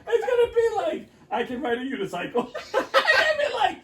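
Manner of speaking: very high voice breaking up with real laughter; can barely get the joke out; laughing. Real, not acted. Friend jokes about a new skill, laughing helplessly. Cracking up while joking.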